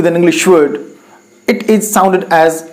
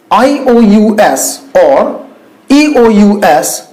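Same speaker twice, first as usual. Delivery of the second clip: usual speaking voice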